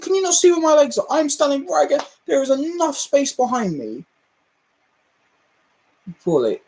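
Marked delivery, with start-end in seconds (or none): 0.0-4.0 retold indignant lecture. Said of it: "I said".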